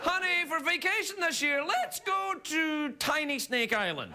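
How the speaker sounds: a lightly voiced scientist pitching a holiday to his wife; mock husband pitch. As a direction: sell the holiday innocently to your wife.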